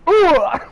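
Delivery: sharp high grunt from a gut punch; pained grunt. Retold or imitated. Punched in the stomach during the fight, loud pained grunt. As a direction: let out a loud winded groan.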